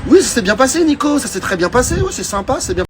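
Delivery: put-on high, sing-song teenager voice; high evasive teen voice; mock teen reply. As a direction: lightly mimic the sheepish kids answering. Teens answering in high voices.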